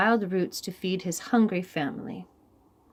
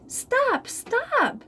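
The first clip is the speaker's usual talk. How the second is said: crying out. Acted. Rabbit yelping as the rock bounces off his head. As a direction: yelp as the rock hits your head. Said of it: "cried Rabbit".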